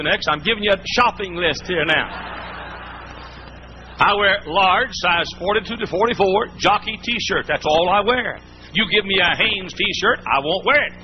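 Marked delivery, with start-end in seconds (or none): none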